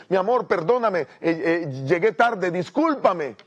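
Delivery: disculpa apurada. Acted. Esposo llega tarde, se disculpa.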